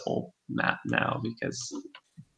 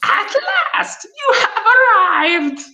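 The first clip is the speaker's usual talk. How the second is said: very high, loud crone cackle; cackling crone; 5 dB louder, slower than usual. Acted. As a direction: screech it like an old hag. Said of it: "her voice cackles like dry weeds".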